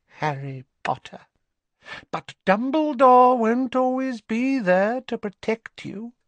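high, cold taunting purr; high mocking Narcissa. A narrator's reading. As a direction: sneer down at him.

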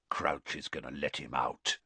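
breathed low and quiet; low, quiet grumble aside. A narrator's reading. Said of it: "Moody breathed quietly".

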